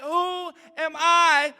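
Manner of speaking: high, slow, pleading; pleading Moses. Retold or imitated. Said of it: "Moses is like".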